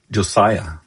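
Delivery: ordinary delivery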